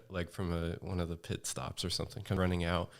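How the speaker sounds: ordinary talk, nothing marked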